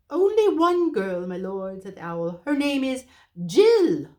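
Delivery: high, loud owl call. Acted. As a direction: raise it for the deaf lord. Owl shouting to deaf dwarf.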